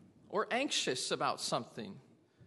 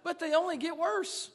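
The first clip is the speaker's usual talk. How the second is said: preaching build; 8 semitones higher, 8 dB louder than usual. Acted. Preaching at full force.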